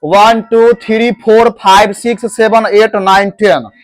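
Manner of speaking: heavy local pronunciation, "thiri, phor, phaib, seban"; mock wrong counting. Shown as a demonstration. Teacher demonstrates how untaught people count, mock mispronunciation. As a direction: rattle the numbers off in a mispronounced schoolroom chant. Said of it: "generally कैसे pronounce करते हैं".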